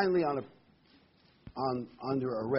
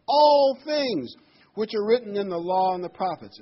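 "all" shot up much higher and louder; stress on all; 7 semitones higher, 10 dB louder than usual. Shown as a demonstration. Preacher stressing all in the verse to the congregation, loud and high. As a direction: hammer "all things". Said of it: "I want to emphasize the word all".

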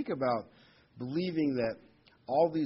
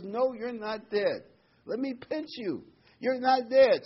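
high, slow, rising to loud; plead, then insist; hammed-up exasperated husband; impatient husband; 9 semitones higher, 6 dB louder than usual. Joke, husband losing patience.

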